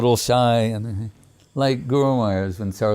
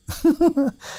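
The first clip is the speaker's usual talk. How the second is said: light laugh. Real, not acted. Laughs at the hidden meaning.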